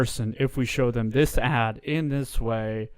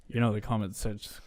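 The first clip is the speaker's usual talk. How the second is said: ordinary delivery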